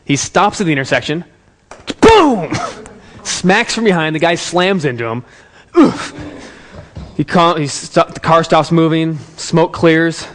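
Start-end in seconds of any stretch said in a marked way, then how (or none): 2.0-2.5 high, explosive imitated impact; loud crash boom. 5.8-6.1 short, high, loud grunt; imitated impact oof. Imitates driver's jolt on impact.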